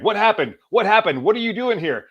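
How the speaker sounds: great uncle panicking over the wounded hunter; panicked uncle. Retold or imitated. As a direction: rush in alarmed, repeating the question.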